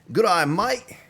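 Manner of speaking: a loud, high mock Australian accent. Streamer thanking chat viewer Mike in mock Australian accent. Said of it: "the only thing I can say with an accent, Australian accent".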